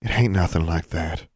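ordinary delivery

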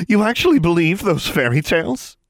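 high, loud mocking scorn; sneering. A narrator's reading. Darla sneering at Alexandra, high loud scorn. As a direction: sneer it at her. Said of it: "Darla sneered".

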